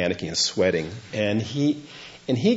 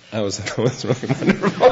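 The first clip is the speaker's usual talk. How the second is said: fond laughter; 2 semitones higher than usual. Real, not acted. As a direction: laugh warmly remembering a friend.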